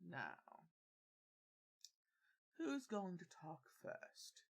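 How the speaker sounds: slow, soft Emperor menace. Acted.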